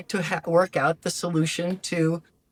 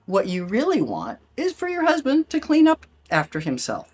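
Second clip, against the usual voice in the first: ordinary delivery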